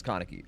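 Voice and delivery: Kaneki as KAH-nuh-kee in an American mouth; front-stressed Kaneki, plainly American; KANEKI approximated. Shown as a demonstration. Streamer giving his closest attempt, Kaneki front-stressed. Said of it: "closest I can get".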